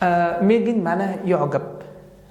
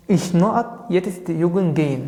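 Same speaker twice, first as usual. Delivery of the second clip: slow dictation; much slower than usual. Shown as a demonstration. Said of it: "بقول لك ich نقط jetzt joggen gehen".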